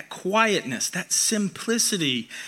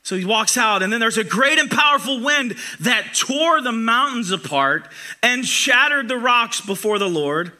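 fiery preaching; 6 semitones higher, 4 dB louder than usual. Acted. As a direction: build each blast of the storm. Dramatic retelling of Elijah.

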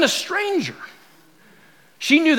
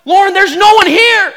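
high, louder exasperated protest; husband insisting over his wife's panic, raised voice; retold yelling; 10 semitones higher, 5 dB louder than usual. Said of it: "we start yelling at each other".

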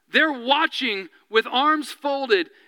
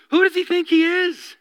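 higher, louder indignant outburst; indignant scribes; 7 semitones higher, 4 dB louder than usual. Retold or imitated. Scribes thinking about Jesus, indignant. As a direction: voice the scribes' offended huff.

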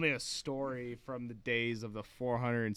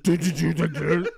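laughing; 6 semitones higher, 14 dB louder than usual. Retold or imitated. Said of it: "I'm like".

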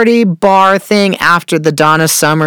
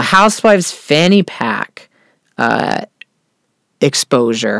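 normal talking voice

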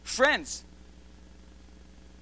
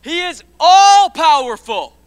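climbing louder and higher into a preacher's shout; preaching at full force; 8 semitones higher, 13 dB louder than usual. Acted. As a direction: build the crowd up, line by line.

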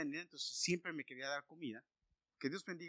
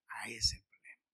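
whisper; 6 semitones lower than usual. Acted. Preacher drops to whisper.

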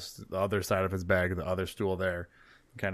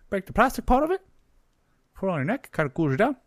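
very high, loud little gnome; hesitant sales patter in a pitched-up gnome voice; helpful gnome; 12 semitones higher, 7 dB louder than usual. Acted. Gnome offering the barkeep a cooling concoction, high-pitched.

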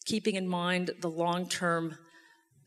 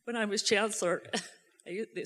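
usual speaking voice

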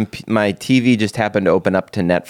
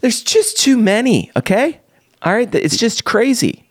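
exasperated; 13 semitones higher than usual. Real, not acted. Exasperated rant about too many apps.

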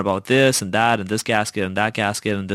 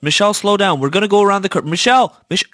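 high, loud, urgent warnings; freaking-out dad; 7 semitones higher, 4 dB louder than usual. Retold or imitated. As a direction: call out her name repeatedly, imitating a panicked father. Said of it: "the dad is freaking out".

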